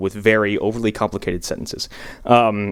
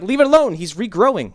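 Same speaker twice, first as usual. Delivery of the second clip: high pleading quote; pleading, pitched up high; squeaky mock protest; mock plea; 9 semitones higher than usual. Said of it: "I was like".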